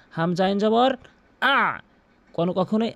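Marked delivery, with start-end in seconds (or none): 1.4-1.8 আ raised and squeezed in mid-throat; throat ayn. Shown as a demonstration. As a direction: press আ from the middle of the throat. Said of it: "হলকের মাঝখান থেকে". (আ হলকের মাঝখান থেকে)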